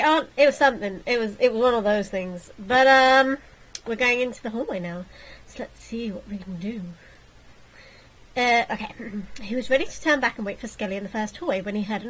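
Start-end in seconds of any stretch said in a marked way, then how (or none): 5.5-6.8 whisper. Real, not acted. Whispered aside.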